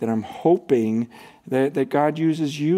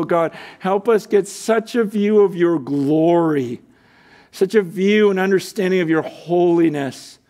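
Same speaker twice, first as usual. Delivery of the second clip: closing prayer. Acted.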